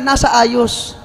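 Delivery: usual speaking voice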